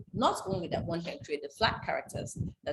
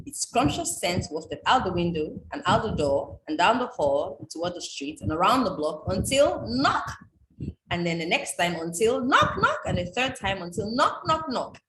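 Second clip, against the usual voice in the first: lively read-aloud refrain; 4 dB louder than usual. Acted. Reading the book's refrain aloud.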